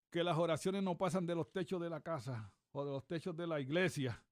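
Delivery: ordinary talk, nothing marked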